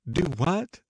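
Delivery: high, startled little question. A narrator's reading. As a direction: ask as if caught off guard.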